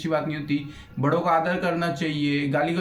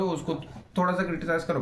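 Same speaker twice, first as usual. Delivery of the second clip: ordinary talk, nothing marked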